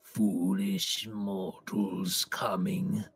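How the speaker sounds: slow, whispered menacing villain; dark lord. Acted. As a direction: hiss it slowly like an ancient evil. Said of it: "And says".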